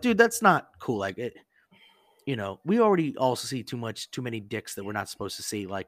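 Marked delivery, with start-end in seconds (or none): 0.0-1.0 high, loud, exasperated complaint; mock scolding. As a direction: tell a roommate off, fed up. Roommates scold naked guy.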